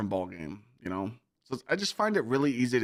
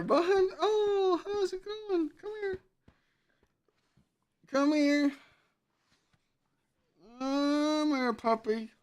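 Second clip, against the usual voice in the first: puppy talk. Real, not acted. Streamer coaxing his puppy to come say hi, high cooing baby talk. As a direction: coo at the dog to coax it over.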